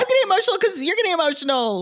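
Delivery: very high, rushed weepy imitation; hammed-up squeaky emotional blubbering; mock crying. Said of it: "like, crying".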